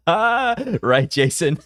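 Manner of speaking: high-pitched, laughing agreement; real laughter breaking through the words. Real, not acted. Host agrees with Jason in chat, laughing.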